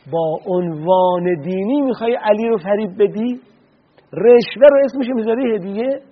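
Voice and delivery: rising outrage at a bribe called a gift; rebuke. Retold or imitated. Ali rebukes the bribe bringer, swelling into an indignant rebuke. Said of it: "حضرت باز توبیخش کردن".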